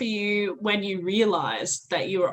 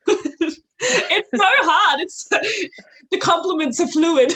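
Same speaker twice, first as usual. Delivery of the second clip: high, slow words broken by laughter; giggling, flustered praise; laughing; 9 semitones higher, 5 dB louder, slower than usual. Real, not acted. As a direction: laugh while fumbling for words. Overcome while giving compliments.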